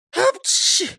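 very high explosive sneeze; acted sneeze. Robber sneezing inside a potato sack, high achoo. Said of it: "чихнув, сказал Ниса Чих".

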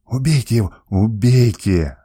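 usual speaking voice

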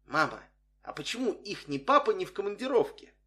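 put-on a touch of a curious girl; curious little girl. A narrator's reading. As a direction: ask like an inquisitive child. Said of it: "с интересом взглянула на меня и спросила".

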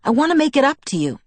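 fast, high-pitched eager plea; begging. A narrator's reading. Jacob wants to make it up to Bella, begging. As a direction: rush it out, pleading to make amends. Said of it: "he begged".

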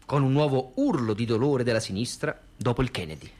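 ordinary talk

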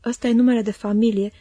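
ordinary delivery

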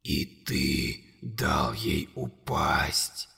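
very low, slow, whispered monster voice. A narrator's reading. Monster whispering accusations to Conor, low and slow. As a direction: loom over the boy and breathe every word.

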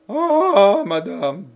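grateful cry. Acted. Marie cries out her gratitude to Jeanne, high and loud. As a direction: cry out in a burst of thanks. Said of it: "s'écria Marie avec un élan de reconnaissance".

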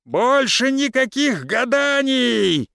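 high, slow drawn-out shout; крик. A narrator's reading. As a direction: shout it from across the house. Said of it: "долетел крик Алексея". (протяжный крик)

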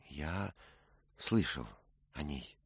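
subdued, faltering answer, very soft; hesitant, softly spoken admission with small pauses; trembling voice. A narrator's reading. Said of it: "Как у тебя дрожит голос". (тихий, дрожащий голос)

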